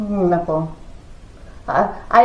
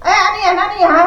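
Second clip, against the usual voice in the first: high mock scolding of kids; scolding like a teacher; 9 semitones higher, much faster than usual. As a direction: play the stern teacher checking on the children. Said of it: "Sabi ko ganun".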